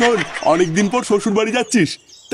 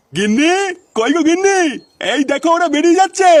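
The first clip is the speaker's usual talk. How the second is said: calling out; 6 semitones higher than usual. Acted. Grandpa calling wife from afar.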